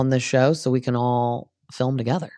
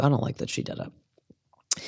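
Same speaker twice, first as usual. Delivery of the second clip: hushed catty aside, under the breath; whispered aside; 7 dB quieter than usual. Real, not acted. Recapper whispering her dislike of Katie to viewers. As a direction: whisper it like a secret dig.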